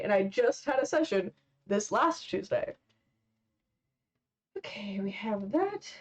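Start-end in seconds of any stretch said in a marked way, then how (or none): 4.6-5.9 whispered aside to herself. Real, not acted. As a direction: murmur it under your breath. Muttering to herself while drawing.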